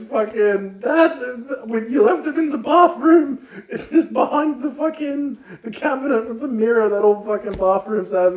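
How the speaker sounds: high, loud, laughing exasperation; fed up with dad, laughing; exasperated daughter. Acted. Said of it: "the daughter goes".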